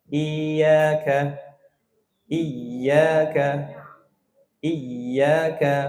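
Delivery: iyyaka tried several ways, weak to pressed; varied tasydid. Shown as a demonstration. Teacher contrasts weak and overpressed readings. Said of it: "terlalu lemah dan jangan juga terlalu ditekan".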